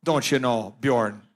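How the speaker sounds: low-pitched Minnesotan dialect voice; mock Minnesotan catchphrase delivery; Minnesotan accent. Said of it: "the great state of Minnesota".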